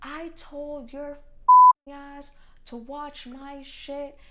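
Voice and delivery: loud, slow furious yell; retold yell. Customer cursing at me before throwing shoes, loud slow yell. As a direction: bellow it out word by word. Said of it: "she goes".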